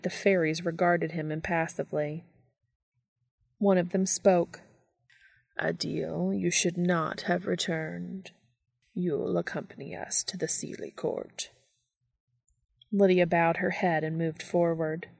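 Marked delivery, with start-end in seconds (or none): 5.6-11.5 cold sweet ancient calm. Acted. Fairy speaks menacingly.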